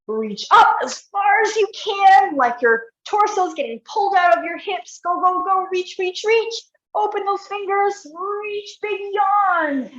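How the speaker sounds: high, pushing encouragement; urging coach. Real, not acted. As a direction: spur them on to reach further.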